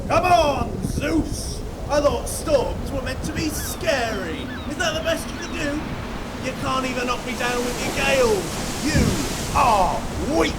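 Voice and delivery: high, strained challenge into the storm; defiant taunt. Acted. Man taunting Zeus in the storm, high defiant yelling. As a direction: yell up at the sky, daring it.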